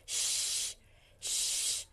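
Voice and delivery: "Sh" as a long voiceless hush. Shown as a demonstration. Teacher shows students the SH consonant, long hush. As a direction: hold "Sh" as if asking for quiet. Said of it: "Parang, sh. Quiet, quiet".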